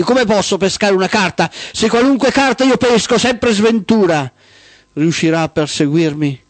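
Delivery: high-pitched, agitated dramatic plea; desperate outcry. A narrator's reading. Trembling chooser protests to pursuing Sventura, desperate outcry. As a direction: cry out as if hounded, pitch climbing.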